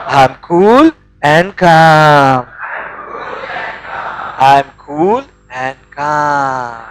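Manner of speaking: calm affirmation. Shown as a demonstration. Seminar leader calming the crowd after shouting, slow and low. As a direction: let it sink in, slow and deep. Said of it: "affirmation of calm, contrasting shouts".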